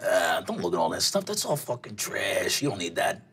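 put-on low, quiet older guy; dismissive older man in a low register; low voice from behind, brushing you off; gruff stranger's voice. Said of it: "you hear a voice behind you".

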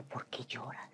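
hushed self-scolding whisper; quiet inner interrogation; whispered self-questioning. Retold or imitated. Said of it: "y decía".

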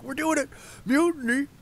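high, loud rebel cry; rallying mutineer shout. Acted. Voices the minions starting a mutiny.

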